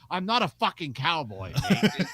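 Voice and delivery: put-on a weak stab at an Australian accent, slowed down; bad Australian accent. Mimicking [name] griping to [name], weak Australian accent. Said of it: "I can't do an Australian accent".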